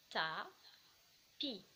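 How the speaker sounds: "Ta, pi" split in two, with a pause between; syllable by syllable. Shown as a demonstration. Teacher counting the syllables of tapis, syllable by syllable. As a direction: say "Ta" ... "pi" as separate syllables. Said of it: "combien il y a de syllabes".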